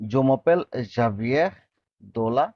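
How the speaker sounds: slow, careful French for learners; slow demo. Shown as a demonstration. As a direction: draw out each syllable for the students. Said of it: "teacher models French line".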